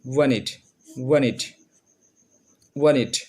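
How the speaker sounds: ওয়ানিট said "wanit" with the t dropped; smart pronunciation. Shown as a demonstration. Teacher giving students a smarter short form, no t. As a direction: clip it to ওয়ানিট, no t. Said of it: "আরও smartly উচ্চারণ করতে চান".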